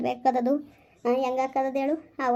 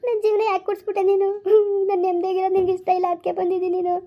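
high, louder tearful wailing; crying; 7 semitones higher, 4 dB louder than usual. Acted. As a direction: sob out the despair. Wife cries, life ruined. (ನನ್ನ ಜೀವನ ಹಾಳಾಯ್ತು ಎಂದು ಅಳುತ್ತಾ ಗೋಳಾಡುವುದು)

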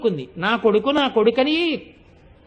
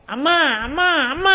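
put-on crying child calling; 4 semitones higher than usual. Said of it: "అని ఏడుస్తాడు".

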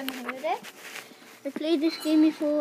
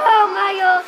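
loud startled cry; startled shout; 3 semitones higher, 11 dB louder than usual. Real, not acted. Gamer hit by a jump scare, shouting loud.

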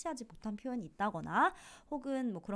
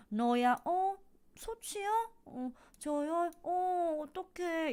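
high, fluttery oh-no voice; hammed-up dismay; mock lament; 9 semitones higher, much slower than usual. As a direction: overplay the fake sorrow. Teacher acts Hoàng's fake lament.

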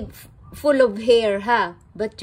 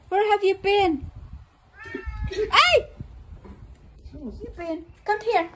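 high calling voice; 8 semitones higher than usual. Real, not acted. Calling the pet.

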